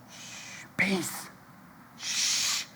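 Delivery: soft hushing whisper; calm whispered shh. Retold or imitated. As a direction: hush them gently, all breath. Jesus calms the storm.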